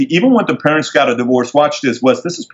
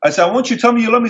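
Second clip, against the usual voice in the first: high, pleading protest; insistent son in a mock argument; retold insistence; 11 semitones higher, faster than usual. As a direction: push back at dad, pitch jumping up. Retold argument with his dad.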